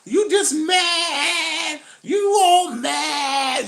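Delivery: slow, high, sung-out taunt; high, slow, sing-song woman caricature; high sing-song mock. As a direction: drag it out high like a teasing woman.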